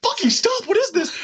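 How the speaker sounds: put-on high, frantic kid voice; desperate child. Child voiced being beaten.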